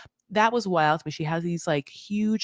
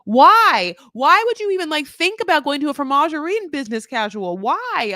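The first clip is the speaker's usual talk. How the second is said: incredulous rant; 8 semitones higher, 5 dB louder than usual. Real, not acted. Ranting at outfit choice.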